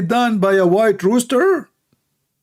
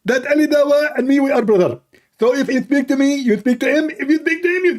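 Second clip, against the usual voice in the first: lightly raised mock debater voice; a hint of a parodied debater, higher; Zakir Naik; 8 semitones higher than usual. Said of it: "Zakir Naik".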